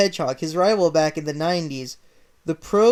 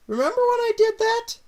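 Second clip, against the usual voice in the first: high, squeaky mock voice; squeaky aside. To the audience about old readings, squeaky high. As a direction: pipe it up high like a cartoon.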